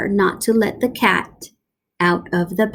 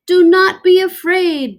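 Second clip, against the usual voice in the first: higher and louder reassurance to a crowd; calm the crowd, big and high; showman doctor; 9 semitones higher, 6 dB louder than usual. Acted. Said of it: "Doctor Varmint told the crowd".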